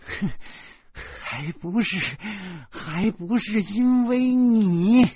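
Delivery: 拖长声音恨恨地说; 咬着字把怨气甩出来. A narrator's reading. 弟弟愤恨重复. (弟弟满眼怒火地顶嘴)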